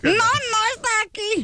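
mother under threat. Acted. Mother to caller, acted fear in Spanish. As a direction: insist in Spanish as a frightened mother. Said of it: "pretend like someone's holding a gun to you".

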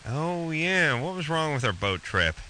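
normal talking voice

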